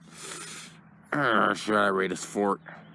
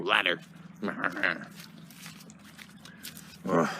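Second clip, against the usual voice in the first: ordinary delivery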